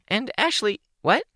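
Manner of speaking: put-on high, bubbly teen girl voice; bright young girl voice, a little shy; Kenzie's voice. Kenzie speaks.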